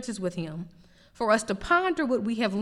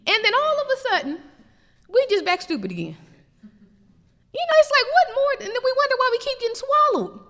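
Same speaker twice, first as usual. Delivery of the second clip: much higher and louder, faster, near shouting; rising preacher fervor; 13 semitones higher, 4 dB louder, faster than usual. Real, not acted. Preacher rousing the congregation about backsliding, fast and loud. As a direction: build the sermon to a peak, faster and faster. Preacher builds to full-force climax.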